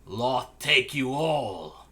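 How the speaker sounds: dark elf assassin. Acted. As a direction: curse them slowly and coldly. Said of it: "Assassin says".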